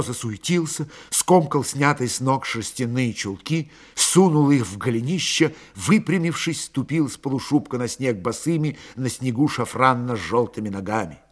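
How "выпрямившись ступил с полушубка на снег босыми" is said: ordinary delivery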